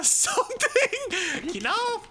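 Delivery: high giggly laugh through words. Real, not acted. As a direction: laugh while saying it. Laughs at chat response.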